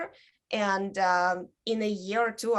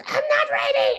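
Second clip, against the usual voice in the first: high-pitched, loud yell; retold backstage yell; 6 dB louder than usual. Performer calling to the host from backstage, yelling. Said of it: "I had to yell from backstage".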